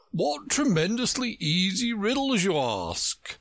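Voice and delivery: high, scornful character voice; mock growl in a high pitch. A narrator's reading. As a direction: scoff at the question, pitched well up. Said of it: "Humpty Dumpty growled out".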